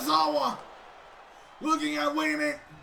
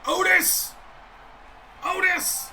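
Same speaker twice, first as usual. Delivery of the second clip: loud high calls of the name; cheering name; 7 semitones higher, 5 dB louder than usual. Real, not acted. Commentator urging Otis on, loud high calls. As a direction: call him out like a fan.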